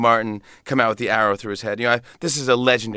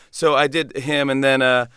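usual speaking voice

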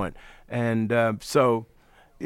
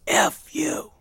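slow, harsh whispered brush-off; imitating Miles's gruff, whispered snarl; 5 semitones higher, much slower than usual. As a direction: rasp out a rude dismissal. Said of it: "in that gruff voice".

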